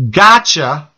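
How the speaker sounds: lightly voiced demon taunt; drawn-out taunt, imitating a gloating demon; a hint of demonic mockery; mocking demon. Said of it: "the voice of a demon said to me".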